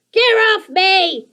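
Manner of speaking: high, slow, loud shriek; a squashed goblin shrieking; shrieking creaker. A narrator's reading. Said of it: "shrieked Scratch".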